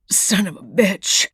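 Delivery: choked, strained curse. Acted. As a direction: force it out half-choked. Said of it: "said Sharp in a choked voice".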